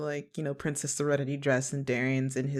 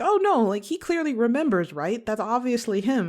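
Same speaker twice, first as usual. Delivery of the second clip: high, louder excited realization; like spotting an obvious twist; mock realization; 8 semitones higher, 4 dB louder than usual. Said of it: "you're like".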